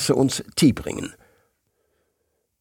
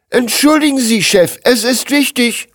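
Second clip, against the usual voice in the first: put-on much higher, louder young man's voice; young assistant interrupting; 14 semitones higher, 5 dB louder than usual. A narrator's reading. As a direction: burst in urgently as a junior employee. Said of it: "unterbrach ein junger Mitarbeiter". (aufgeregter junger Mitarbeiter, hohe Stimme)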